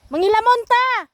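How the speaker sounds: high, loud holler to someone far off; shouting. Real, not acted. Vlogger asks a cousin to join the weeding, shouting. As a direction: call out an invitation that carries. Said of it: "Sinasabihan ko yung pinsan ko".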